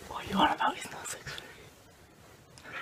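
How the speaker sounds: hushed whisper so no one wakes. Real, not acted. Filming early morning beside sleeping people, whispering. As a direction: breathe it, barely voiced.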